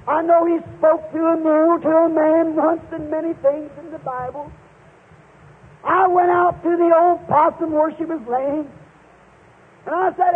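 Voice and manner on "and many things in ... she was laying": ordinary talk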